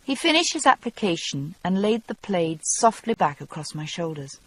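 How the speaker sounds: normal talking voice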